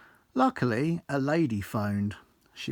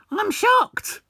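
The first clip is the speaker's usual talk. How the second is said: very high, much louder shocked squeal; mimicking Charlotte, shocked; 9 dB louder than usual. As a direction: shriek it like a scandalised boss. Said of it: "she said".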